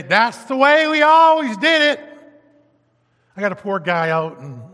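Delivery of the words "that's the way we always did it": high, loud mocking quote; stubborn churchgoer mocked; mock stubborn congregation. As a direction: belt it out like a stubborn old-timer. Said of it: "and they say".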